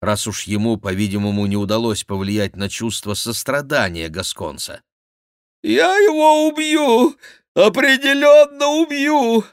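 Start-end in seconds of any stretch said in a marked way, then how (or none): 5.6-9.4 very high, loud, drawn-out threat; plaintive cry. A narrator's reading. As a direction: wail the threat slowly. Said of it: "жалобно кричал монах". (жалобный высокий крик монаха)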